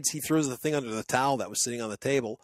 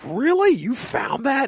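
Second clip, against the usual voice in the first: very high, louder astonished question; amazed really; 12 semitones higher, 5 dB louder, slower than usual. Retold or imitated. Hearing his friend found the drone, very high amazement. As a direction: gasp out your amazement. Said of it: "And I'm like".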